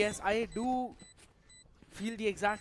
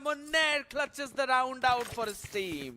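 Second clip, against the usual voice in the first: high, slow triumphant call; esports caster; 6 semitones higher, slower than usual. Acted. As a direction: savor the clutch announcement. Announcing the clutch.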